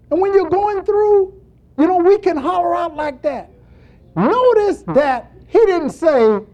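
high, loud pulpit delivery; full-force preaching. Real, not acted. Preacher telling the church about crying out, preaching at full force.

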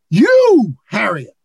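single her out with a sudden cry; calling one person by name; pointed call. Acted. Preacher voicing God's personal call.

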